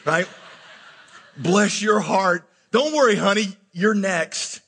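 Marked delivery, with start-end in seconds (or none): 1.4-4.6 put-on slow, drawn-out old-lady patronizing; condescending. Little old ladies console a single man at weddings, condescending. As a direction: imitate a pitying church lady. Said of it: "so condescendingly".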